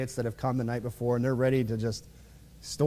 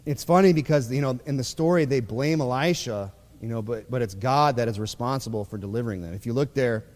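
ordinary talk, nothing marked

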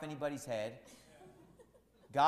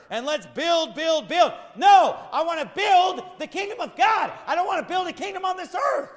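building to a shout, higher and louder; preaching at full force; 11 semitones higher, 10 dB louder than usual. Real, not acted. Pastor shouts to the congregation against building programs. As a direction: hammer it home from the pulpit.

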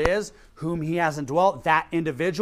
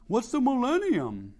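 mock clueless voice, a bit higher; clueless churchgoer; 6 semitones higher than usual. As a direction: play dumb asking "What's the millennium?". Mocking churchgoers' clueless question.

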